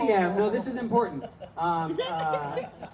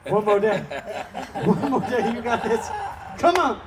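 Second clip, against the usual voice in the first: louder, higher, cheering on a struggling store; rally it like a coach, laughing through it; pep talk to a shop about to close; mock pep talk; 5 semitones higher, 6 dB louder than usual. Cheering on the Family Dollar.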